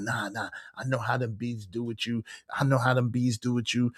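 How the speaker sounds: suspicious wife. Retold or imitated. Wife doubting her husband's trip, quiet suspicious imitation. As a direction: mimic her distrust under your voice.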